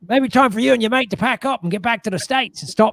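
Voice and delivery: put-on Australian reproach; awful Australian accent. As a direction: imitate an Australian host sending guests packing. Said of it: "My awful Australian accent".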